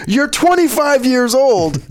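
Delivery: high, drawn-out heckle at the screen; exasperated yell. Retold or imitated. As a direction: yell it out in frustration, stretching the words. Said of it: "And I yell out".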